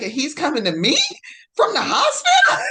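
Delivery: can't believe it, laughing high and loud; high squealing laugh of disbelief. Retold or imitated.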